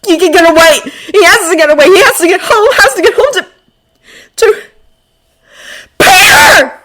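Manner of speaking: frantic, climbing to a high scream; mounting desperation. A narrator's reading. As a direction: build from urgency to a desperate screamed name. Desperate thoughts build to scream.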